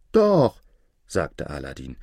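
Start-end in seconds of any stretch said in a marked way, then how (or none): 0.1-0.6 put-on high, loud boyish protest; insistent. A narrator's reading. Aladin contradicting his mother, insistent.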